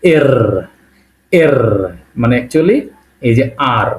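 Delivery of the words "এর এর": এর, with a rolled র; এর with trilled r; Russian er. Shown as a demonstration. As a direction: say এর like Russian Р, not পি. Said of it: "রাশিয়ানে এটাকে আমরা বলবো".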